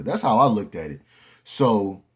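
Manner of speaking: ordinary talk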